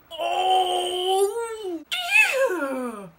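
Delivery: high loud yell; pained yell. Real, not acted. Yelling in pain at the stuck mask. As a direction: cry it out loud.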